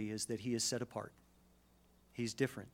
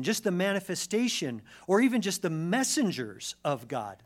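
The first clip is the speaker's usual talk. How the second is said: louder, higher emphatic sermon voice; forceful preaching; 5 semitones higher, 7 dB louder than usual. Real, not acted. Preacher telling how people fell before God, forceful preaching. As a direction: drive each phrase home to the congregation.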